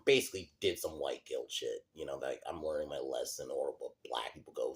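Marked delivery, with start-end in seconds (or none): 2.5-4.7 whispered mock-earnest confession. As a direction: breathe it out like a fake-humble apology.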